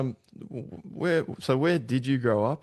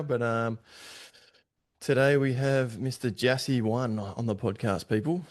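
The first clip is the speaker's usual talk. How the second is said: hyping up the guest for the podcast audience, mild announcer voice; podcast host intro; 3 semitones higher than usual. Acted.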